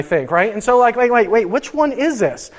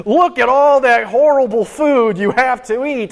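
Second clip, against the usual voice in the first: higher, louder hammed-up TV host voice; game show host; 5 semitones higher, 4 dB louder than usual. Preacher imitating Fear Factor host, mock showman voice. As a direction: announce the gross food like a TV host. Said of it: "like an episode of Fear Factor".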